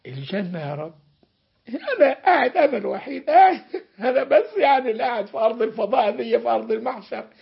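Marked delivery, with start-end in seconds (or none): 1.7-7.3 laughing complaint. Acted. Servant complains he's left alone.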